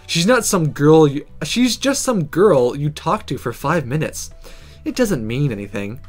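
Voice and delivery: ordinary delivery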